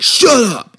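high, loud burst of defiance; explosive shouted shut up; harsh retort. Shown as a demonstration. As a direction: yell it sharply at a parent. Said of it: "I would never have been like".

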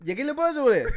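high, loud, slurred Jamaican-accent imitation; mock Jamaican accent. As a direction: run it together in a mock Jamaican lilt. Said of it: "the Jamaican accent".

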